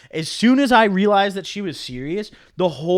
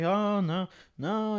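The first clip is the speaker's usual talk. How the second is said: fake modesty; 3 semitones higher, 8 dB quieter, much slower than usual. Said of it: "then I sound like an idiot".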